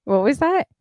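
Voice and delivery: high startled question; surprised. Real, not acted. Reacting to odd sound.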